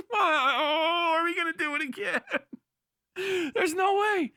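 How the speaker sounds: high, cracking laugh through the words; laughing disbelief. Real, not acted. Laughing in disbelief at history repeating.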